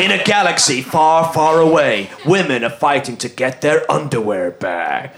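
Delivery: low, dramatic trailer read; deep epic announcer; movie trailer voice. Pretend. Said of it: "use the movie trailer voice".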